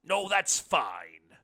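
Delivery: slow, loud and booming; said loudly. A narrator's reading. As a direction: turn it down loudly and slowly. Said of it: "the blind man said loudly".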